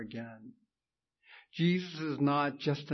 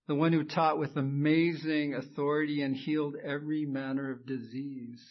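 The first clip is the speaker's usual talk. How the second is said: ordinary talk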